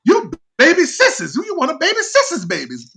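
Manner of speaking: loud, very high whooped welcome; imitating gushing relatives. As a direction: falsetto gush, long drawn "oh" to start. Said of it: "they say".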